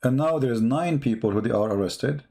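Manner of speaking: usual speaking voice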